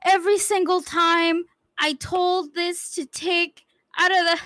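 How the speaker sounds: high, loud anguished protest; betrayed and heartbroken. Real, not acted. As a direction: cry out in betrayal. Player betrayed by teammate.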